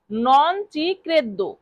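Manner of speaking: very slow teaching read; slow drill. Shown as a demonstration. Teacher repeating the phrase for students, very slow. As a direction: stretch every syllable for learners. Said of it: "non credo মানে বিশ্বাস না করা".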